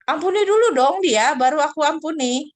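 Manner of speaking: put-on God telling the child to forgive first; voicing God. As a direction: insist like a stern parent. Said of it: "Tuhan akan bilang".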